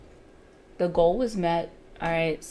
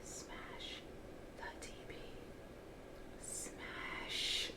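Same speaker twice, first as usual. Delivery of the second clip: whispered urging; tense whisper; 21 dB quieter, much slower than usual. Real, not acted. Trader whispering at the chart to hit target. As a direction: breathe the words, willing it to hit.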